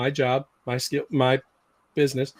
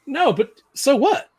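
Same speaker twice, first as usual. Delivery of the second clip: ordinary delivery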